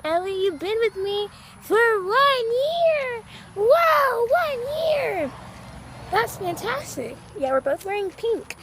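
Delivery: high, slow sing-song pet talk. Real, not acted. Owner cooing to her dog Ellie on their anniversary, baby talk.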